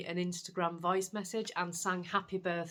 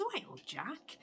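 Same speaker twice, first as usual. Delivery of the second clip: soft, higher voice, soothing a cat; coaxing nurse; 6 semitones higher, 7 dB quieter than usual. Retold or imitated. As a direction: hush the cat like a kind nurse. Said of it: "She goes".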